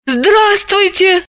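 high, slow startled hello; flustered greeting. Acted. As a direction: caught off guard, stretch it. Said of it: "растерялась она".

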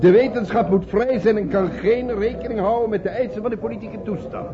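loud, insistent objection; heated protest. Acted. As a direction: defend science forcefully. Professor protests on principle.

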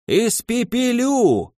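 high, slow, rough fury; enraged crone. A narrator's reading. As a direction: howl it like a frenzied witch. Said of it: "Голос взбесившейся бабки".

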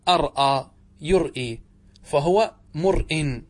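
أرأى يرئي with separate hamza catches; original hamza forms. Shown as a demonstration. Teacher shows the original form, distinct glottal stops. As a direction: slowly sound each hamza, ar-aa, yur-ee. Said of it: "وأصله".

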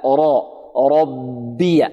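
"ربي" with full thick ر, then light ب; heavy ra. Shown as a demonstration. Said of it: "لا".

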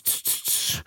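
soft voiceless repeated hissing clicks; imitated creature noise. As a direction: mimic the creatures' quiet "tsch" sounds. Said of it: "sie machen's". (leise nachgeahmtes Zischen)

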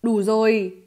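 loud, angry command; angry shout. Acted. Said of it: "Tiêu Viêm giận dữ quát to". (giận dữ quát to)

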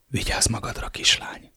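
soft, breathy whisper of goodbye; whispered farewell. Acted. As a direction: whisper it close to her ear. Tender farewell to wife. (suttogva, gyengéden búcsúzva)

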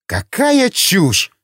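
very high, stretched-out exclamation; indignant outburst. A narrator's reading. Former judge rejects the collider talk, indignant and incredulous. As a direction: dismiss it with drawn-out scorn, pitch soaring. Outraged dismissal in the argument.

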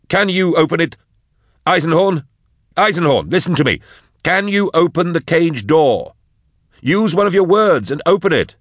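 begging him to open the cage. Acted. Drusha pleading with Eisenhorn.

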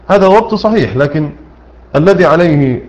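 ordinary talk

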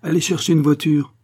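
commissioner's forceful instruction; curt order. A narrator's reading. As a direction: call out an order to a subordinate. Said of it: "ajouta-t-il".

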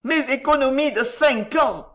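grinding, loud cry of loss; miser's grating wail; grating lament. A narrator's reading. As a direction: grind it out in despair. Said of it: "grinçait-il".

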